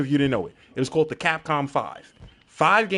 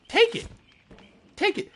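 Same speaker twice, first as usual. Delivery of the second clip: squeaky high take it; mocking taunt; 3 dB quieter than usual. Taunting scared survivors in a squeaky voice.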